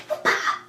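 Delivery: unvoiced-style puffed "whoop-ah" sound effect; imitated impact whoosh. Stopping the car with his hand, mock impact noise. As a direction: puff out a mock action sound.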